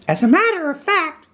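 very high character voice; Rabbit. A narrator's reading. Rabbit answering his guest Pooh, very high voice. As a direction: pitch it way up, small and proper.

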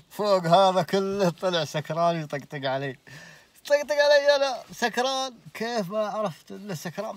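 laughing indignation; laughing while telling. Real, not acted. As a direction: breaks up laughing in disbelief. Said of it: "laughing at being mocked".

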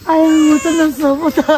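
loud tearful wail; anguished mother's lament; tearful reluctance. Acted. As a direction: cry out, unable to let go. Mother cannot part with baby.

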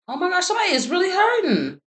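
high, sympathetic exclamation; pained surprise. Real, not acted. Reader reacting to someone's pain, high sympathetic exclamation. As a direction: cry out in sympathy.

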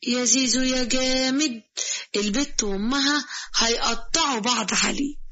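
ordinary delivery, nothing marked

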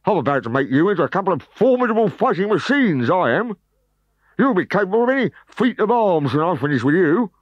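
menacing threat. Acted. Trainer threatens recruits.

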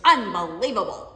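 exasperated scoff. Retold or imitated. Mom scoffing at daughter's boundary, loud exasperated scoff. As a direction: throw the word out in disbelief.

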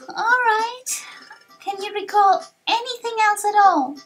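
high, sighing youngster voice; sigh, then ask high and hopeful; let-down young hero. Acted. Disappointed hero asks on.

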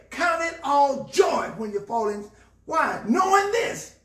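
high, emphatic sermon delivery; impassioned preaching. Real, not acted. Preacher urging people to smile through trouble, impassioned preaching. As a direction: drive the point home with rising fervor.

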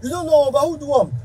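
mocking quote. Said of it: "You say".

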